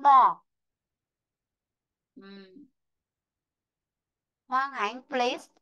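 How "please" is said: ordinary delivery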